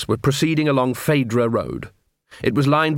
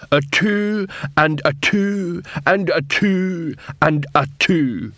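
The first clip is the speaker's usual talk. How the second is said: slow, raised-pitch counting chant; slow-witted troll; 6 semitones higher, much slower than usual. Acted. Troll counts eight fingers in twos, slow plodding. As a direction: plod through it like a troll.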